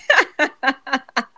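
real burst of laughter; laughing. Real, not acted. Host cracking up at the poison-juice mix-up, real laughter.

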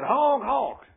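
high, loud mock car horn; imitated honk. Preacher honking at the driver with the Jesus sign, loud mock horn. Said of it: "I said".

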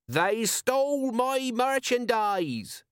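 very high, drawn-out public cry; shouted outrage. A narrator's reading. Wronged merchant to the crowd, shouting. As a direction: proclaim the grievance slowly at a high pitch. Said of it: "shouted a speaker opposite him".

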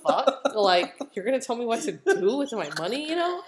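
high, loud retold indignation; indignant. Acting out cousin's indignant reaction.

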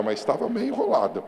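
loud, driving sermon voice; fiery pulpit preaching; preaching at full force. Acted. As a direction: preach it out to the whole church. Preacher shouting his testimony.

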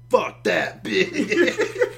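loud, very slow, laughing high dismissal; laughing mock retort. Friend mocking his choice to ignore God's sign, loud laughing drawl. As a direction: drag it out, cracking up as you say it.

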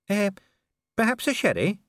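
put-on high, hesitant young voice; Cheery. Cheery asks shyly.

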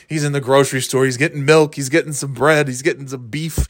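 ordinary talk

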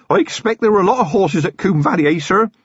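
put-on eager young constable voice; keen young constable. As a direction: chatter away eagerly at your commander. Feeney's eager chatter.

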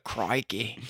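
bad Australian accent. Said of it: "I can't do accents".

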